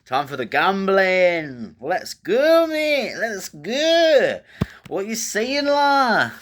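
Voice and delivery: high, hammed-up Aussie bloke; mock Australian. Host hyping the listeners up in a hammed-up Australian accent. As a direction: put on a cheeky Australian accent, pitched well up. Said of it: "we're just speaking in Australian".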